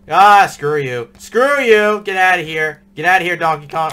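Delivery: loud frustrated shouting; angry yell. Real, not acted. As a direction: shout at the monster. Yelling at monster in game.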